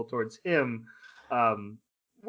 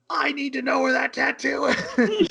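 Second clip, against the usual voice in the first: high, pushed put-on scream; mock scream; 11 semitones higher, 4 dB louder than usual. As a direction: shout it desperately, much higher.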